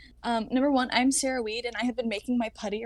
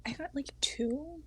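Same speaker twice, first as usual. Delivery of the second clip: whisper; 10 dB quieter than usual. Real, not acted. Whispered score.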